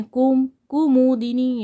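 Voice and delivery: कुमुदिनी slowly, syllable by syllable; slow correct form. Shown as a demonstration. Teacher saying the correct word slowly for the students. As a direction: draw out कुमुदिनी carefully. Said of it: "तब हमारा कुमुदिनी".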